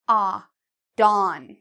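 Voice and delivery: dawn with a wide open ah. Shown as a demonstration. Teacher modelling dawn for a quiz. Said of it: "ah sound".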